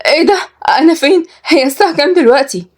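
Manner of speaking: high-pitched, confused waking voice; startled bewilderment. Acted. Kenzi jolted awake asking where she is, bewildered. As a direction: wake up alarmed and puzzled. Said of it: "قامت كنزي بفزع وقالت باستغراب".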